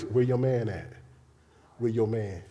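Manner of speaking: low, knowing question; lightly voiced low, knowing Jesus; Jesus asking. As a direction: ask it low and sly. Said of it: "he said like this".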